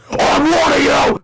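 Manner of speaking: high, loud, slow threat; villain cry. Acted. Robot villain threatening the boys, loud. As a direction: bellow the warning. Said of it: "cried the turbocharged Johnson".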